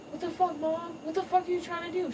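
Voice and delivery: high, indignant cartoon-son voice; high-pitched outraged young lion; upset son. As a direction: demand an answer from mom in disbelief. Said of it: "Kovu's like".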